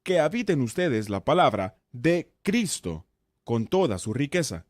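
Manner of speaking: "de Cristo" slowed, each word set apart; stress on de Cristo. Shown as a demonstration. Teacher moving to another word, stress on "de Cristo". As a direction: pause around "de" and "Cristo" to make them stand out. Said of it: "Ahora enfaticemos en otra palabra".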